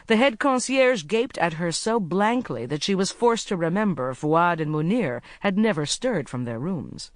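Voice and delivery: ordinary delivery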